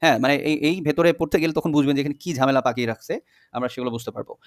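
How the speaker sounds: normal talking voice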